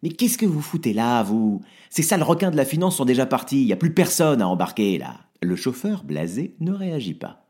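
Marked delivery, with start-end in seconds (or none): 0.0-5.2 loud, higher angry tirade; furious rant; irritated. Acted. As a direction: tell the driver off. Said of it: "énervé au chauffeur".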